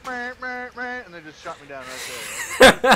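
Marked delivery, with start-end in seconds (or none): 0.1-1.0 high, loud mock alarm "meh"; imitating a buzzer, teasing; mocking blah blah. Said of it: "it just goes".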